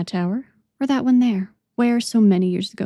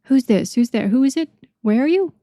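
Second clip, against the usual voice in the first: rising higher and louder with surprise; mild surprised calling out; surprised cry; 5 semitones higher, 4 dB louder than usual. A narrator's reading. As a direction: call out, startled, climbing with each question. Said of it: "cried the voice, surprised".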